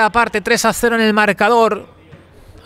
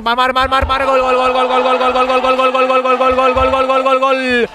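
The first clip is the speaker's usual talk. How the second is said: breakneck goal commentary; 3 semitones higher than usual. Real, not acted. Goal scored, live commentary.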